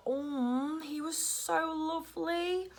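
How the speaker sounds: high, soft, slow gush; gushing coo. Retold or imitated. Describing the client's presents, cooing fondly. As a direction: melt over how sweet he was.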